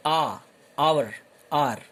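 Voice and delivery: आवर said as "our" with no h sound; teacher models hour with the h silent. Shown as a demonstration. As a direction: start on the open vowel आ, skip the h. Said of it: "हावर नहीं बोलते हम इसको".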